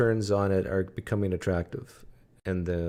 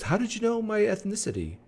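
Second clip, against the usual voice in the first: much higher voice for the caller's surprised question; quoting the caller; 12 semitones higher than usual. Retold or imitated. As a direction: pitch it up high, as the puzzled caller. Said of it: "He goes".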